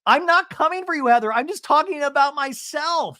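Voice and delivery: high, loud mock protest; mock indignant. Recapping a heated cast argument.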